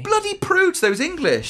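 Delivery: mock outrage.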